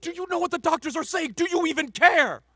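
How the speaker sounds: angry confrontation. Acted. Father berating wife over sick daughter, angry confrontation. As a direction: build to a high, loud accusation.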